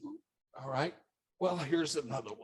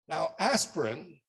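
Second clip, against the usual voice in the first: ordinary talk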